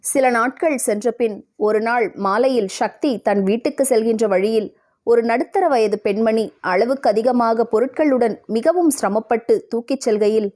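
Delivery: ordinary delivery, nothing marked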